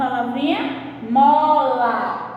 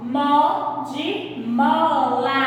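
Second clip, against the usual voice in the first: Mo de mola drawn out very slowly; much slower than usual. Shown as a demonstration. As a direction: stretch each word for the kids. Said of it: "Como é o mo?". (bem devagar, palavra por palavra)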